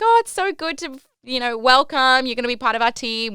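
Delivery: high, eager greeting; a lightly voiced upbeat cruise host; bubbly welcome. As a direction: gush like a cheery host welcoming you. Host greets new crew member.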